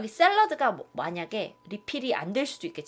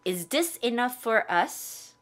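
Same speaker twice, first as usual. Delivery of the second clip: very slow, each word spelled out; slow demo; much slower than usual. Shown as a demonstration. Teacher modelling for the class, very slow. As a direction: model it slowly for the class to repeat. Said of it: "따라해볼까요?".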